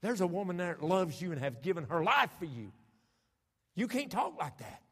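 rising to a loud, high plea; pleading rebuke. Retold or imitated. Pastor urging Bobby to live, loud and pleading. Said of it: "but I said".

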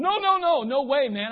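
high, loud refusal; indignant kid captain yelling no; loud protest. Retold or imitated. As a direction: shout it down like a bossy kid captain.

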